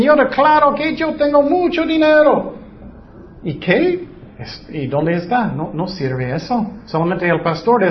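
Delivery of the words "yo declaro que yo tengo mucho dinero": higher, mock-confident claim; mocking quote. Preacher mocks prosperity believers, high mock voice. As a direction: parody a believer declaring riches. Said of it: "Muchos dicen".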